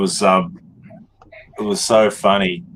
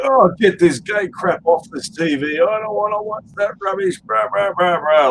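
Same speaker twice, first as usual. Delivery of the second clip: high, loud complaining rant; mock man's man; 13 semitones higher, 3 dB louder than usual. Retelling a pub customer's gripe about netball, mock blustering rant. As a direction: imitate a blustering bloke griping at the telly. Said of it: "like this".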